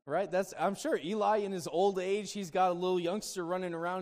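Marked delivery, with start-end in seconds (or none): none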